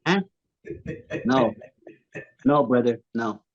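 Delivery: a touch of De Niro tough-guy menace; lightly mock mobster taunt; De Niro horse. Mock De Niro horse taunting about his head. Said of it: "De Niro as a horse".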